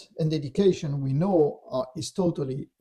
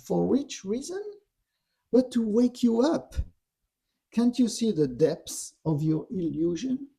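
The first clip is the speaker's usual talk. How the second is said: raised pitch, goading rhetorical questions; taunting; 6 semitones higher than usual. Retold or imitated. Dark voice mocking Yeshua's illusion, taunting. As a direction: imitate a tempter needling with lifted question endings. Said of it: "he's really taunting him".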